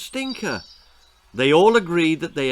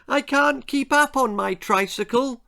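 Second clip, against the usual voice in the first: put-on little girl; 10 semitones higher than usual. A narrator's reading. Little sister voiced in story.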